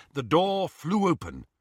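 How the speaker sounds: ordinary delivery, nothing marked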